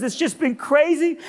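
high-pitched overwrought woman; high, worked-up put-on voice; emotional cleaning lady. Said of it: "I'm sorry to be emotional".

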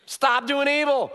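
high, loud, slow preached command. Shown as a demonstration. Pastor answering a mock request to be clearer, loud and high. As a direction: hammer it home so nobody misses it. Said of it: "could you be a little more clear".

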